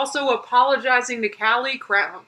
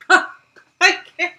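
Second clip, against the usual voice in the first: breaks into a real laugh, louder and higher; can barely get the words out for laughing; incredulous laugh-through; 7 semitones higher, 6 dB louder than usual. Real, not acted. Laughing at the clip's excuse.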